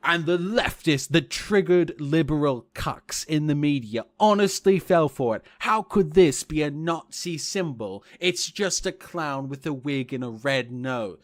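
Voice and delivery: mock a smug YouTube pundit; Paul Joseph Watson impression. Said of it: "him saying".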